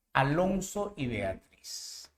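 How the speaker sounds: drawn-out dictating voice; slow teacher dictation; slow, soft dictation. Shown as a demonstration. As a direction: spell it out slowly for the students. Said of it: "teacher dictating slowly".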